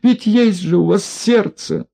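high pleading wail; wailing plea. A narrator's reading. Женщина причитает, умоляя инспектора о пощаде. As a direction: beg for mercy, lamenting. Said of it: "запричитала в постели женщина".

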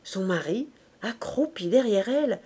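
usual speaking voice

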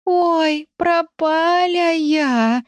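high, very slow whimpering sob; whisper sobbing. Acted. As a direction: sob it out, terrified kitten. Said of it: "беззвучно всхлипывал Рыжик".

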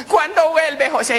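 high comic female voice; eager woman. Acted. Paola asks José to come back, eager. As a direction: ask eagerly in falsetto.

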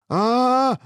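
very high, loud sustained scream; battle scream. A narrator's reading. Hero rushing the head with a sword, high scream. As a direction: scream as you charge. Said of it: "закричал герой".

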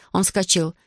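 ordinary delivery, nothing marked

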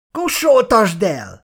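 shrill harsh demand. A narrator's reading. Fat woman demands silencing her.

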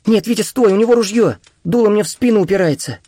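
put-on high, urgent girl's cry; frightened high-pitched warning; alarmed warning. A narrator's reading. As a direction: plead with him to stop. Катя под дулом предупреждает Витю.

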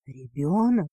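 low, stunned husky question; lightly husky male voice; husky exhale. A narrator's reading. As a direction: breathe it out low, a little hoarse. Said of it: "хрипло выдохнул парт-кардинал".

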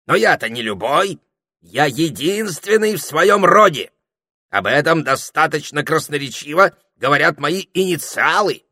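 loud, high, proud parrot declaration; self-important. A narrator's reading. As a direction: proclaim it with pompous pride. Parrot boasts of being unique.

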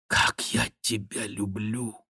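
slow, soft words of love; whispered declaration. A narrator's reading. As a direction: murmur it slowly and tenderly, a little hushed. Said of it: "прошептал Бюсси".

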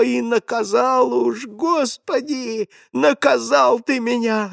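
high, slow, crying lament rising to a shriek; old man cries out to God in anguish before the boy; anguished wailing. A narrator's reading. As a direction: wail it out as a broken old man begging God. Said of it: "Голос деда поднялся до пронзительного визга".

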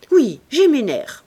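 sharp, loud, dry retort; curt reply. A narrator's reading. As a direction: bite the words off. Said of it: "répondit-elle sèchement".

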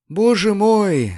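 high, slow moan of dismay; wailing landlady. A narrator's reading. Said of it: "снова простонала госпожа Бенсусон".

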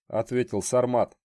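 plain reading voice

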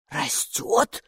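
voiceless, gasping echo; gasped question. A narrator's reading. Gatekeeper hears it is growing, breathy astonished gasp. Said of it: "ахнула привратница".

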